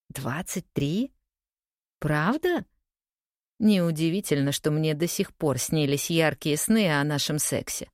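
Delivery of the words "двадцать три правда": slow questioning echo with rising end; incredulous echo. A narrator's reading. Woman doubting her former lover's boast, incredulous. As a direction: echo it back slowly, in mild disbelief.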